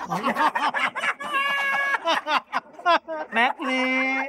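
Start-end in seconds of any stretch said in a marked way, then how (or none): 0.4-3.3 shrieking laugh. Real, not acted. Host laughing at the lisp joke, high shrieking laugh. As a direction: crack up in a squeal.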